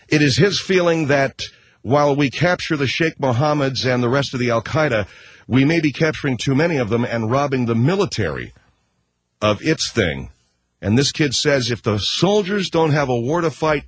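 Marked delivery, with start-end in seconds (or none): none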